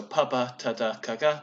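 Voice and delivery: voiceless then voiced in each pair of "p, p, t, t, k, k"; voicing contrast. Shown as a demonstration. Conlanger listing proto-language stops, each voiceless one then its voiced twin. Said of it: "stops with voicing distinction".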